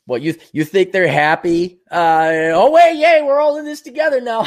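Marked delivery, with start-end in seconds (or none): 2.5-4.4 high, loud sarcastic "yay"; mock cheer. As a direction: ham up fake delight at the sudden unity. Mocking leftists' sudden unity.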